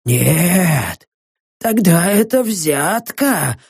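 a dying man raving to someone unseen; delirious muttering. Acted. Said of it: "И опять лихорадочное бормотание".

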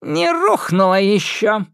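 high, slow defiant witch; fearless old witch. Acted. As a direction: stand firm, old witch. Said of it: "бесстрашно шагнула вперёд Яга". (бесстрашная старая ведьма)

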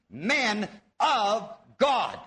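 slow, spaced words building to a weighty GOD; men of God, each word set apart, "God" landing firmly; stress on God. Shown as a demonstration. Teacher shows how the genitive reads, stress on God. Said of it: "putting the emphasis on God".

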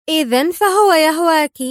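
high, loud jeer; mockery. A narrator's reading. Sonia teasing Mona about love, mocking. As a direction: throw it out as a taunt. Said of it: "قالت سونيا في سخرية".

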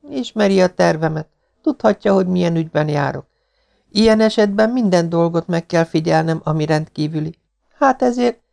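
put-on high-pitched girl's voice, explaining; earnest young woman. Girl explains her mission to the Marquis, earnest.

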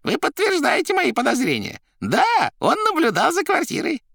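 very high, loud character voice; excitable Koroviev. A narrator's reading. Koroviev confirming his suspicions, high and excitable. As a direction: exclaim theatrically in a high voice.

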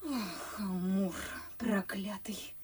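hissed curse under the breath; whispered aside. Acted. Maid whispering a curse at Cupid. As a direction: whisper it with a sigh. Liza cursing love aside.

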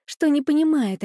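ordinary delivery, nothing marked

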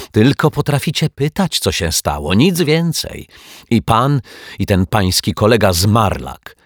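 high, loud exasperated scolding; huffy snapping. A narrator's reading. Neighbour scolding the detective and his colleague, huffy. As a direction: scold with pitch leaping up. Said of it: "fuknęła pani Majewska".

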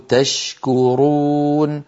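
slow تشكرون, ش not merged into ك; clear shin. Shown as a demonstration. Teacher repeating تشكرون to show izhar. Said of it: "ندغم الشين في الكاف ولا شيء".

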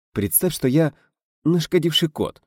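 plain reading voice

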